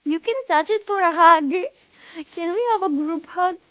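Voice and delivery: put-on Russian accent. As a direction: play it big with a Russian accent. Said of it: "I have to do it with a Russian accent".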